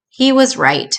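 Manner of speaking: ordinary delivery, nothing marked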